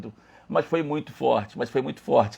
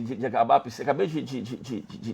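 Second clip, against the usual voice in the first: ordinary delivery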